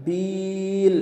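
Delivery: "বিল" with a very long i; long madd. Shown as a demonstration. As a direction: hold the vowel in "বিল" three counts. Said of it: "বিলে তিন আলিফ টান দিব". ("বিল"-এ তিন আলিফ লম্বা টান)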